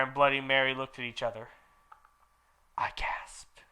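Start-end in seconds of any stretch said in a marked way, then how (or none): 2.8-3.6 breathy whisper of the line; whispering narrator; whispered gasp. A narrator's reading. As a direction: drop to a hushed whisper.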